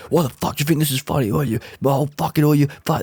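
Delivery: hammed-up angry meltdown; customer going off. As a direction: imitate a man ranting until words fail him. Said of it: "he just started going off".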